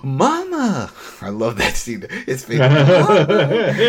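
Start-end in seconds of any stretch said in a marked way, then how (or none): 0.0-0.9 much higher, calling out to his mother; a cracked, high call of Mama; mock overjoyed son crying Mama. 2.6-3.8 loud, laughing cry of Mama; hammed-up son shouting for Mama; laughing Mama call. Teddy calling out, repeated.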